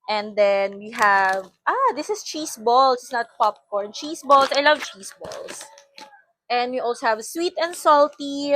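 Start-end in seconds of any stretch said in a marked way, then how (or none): none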